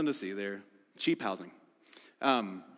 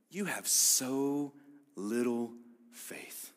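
soft rebuke; 3 semitones lower, 6 dB quieter, much slower than usual. Retold or imitated. Jesus rebuking Peter quietly.

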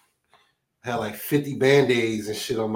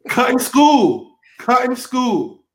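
high, drawn-out holler; singsong heckle, stretched out; mock scolding callout; 11 semitones higher, 5 dB louder, much slower than usual. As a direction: call it out slowly, pitched way up. Teasing callout to the storyteller.

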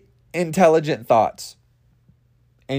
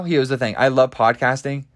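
usual speaking voice

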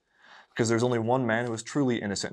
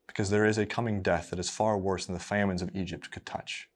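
ordinary delivery

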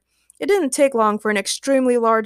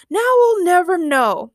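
high, loud, drawn-out lament; disappointed Ray; 8 semitones higher, 6 dB louder, slower than usual. A narrator's reading. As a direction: whine it slowly in disappointment. Ray laments the missed outcome.

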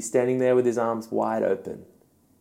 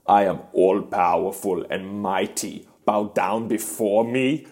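hammed-up German voice of an almighty ruler; mock German accent. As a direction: command pompously in a put-on German accent. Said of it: "I also don't know why he's German".

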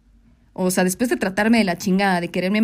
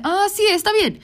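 high-pitched placating agreement; retold appeasing yes; mock compliance; 10 semitones higher than usual. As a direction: agree too readily, pitch way up. Acts agreeing to his demand.

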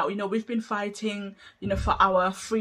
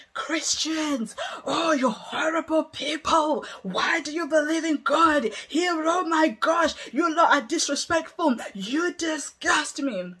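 high, loud contemptuous shouting; staged hateful tirade, pitched high and loud; hateful rant; 7 semitones higher, 3 dB louder than usual. Shown as a demonstration. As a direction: rant at them like you despise them. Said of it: "'Cause of my tone, my pitch".

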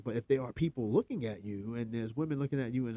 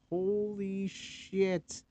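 slow, drawn-out, higher disbelieving "holy shit"; stunned; 6 semitones higher, much slower than usual. Real, not acted. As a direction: let the shock stretch out slowly. Reacts to the list item.

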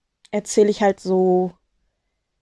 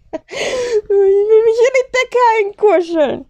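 high, slow, giggly whine; laughing; 12 semitones higher, 3 dB louder, slower than usual. Real, not acted. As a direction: giggle through the words. Giggling while wrapping in blanket.

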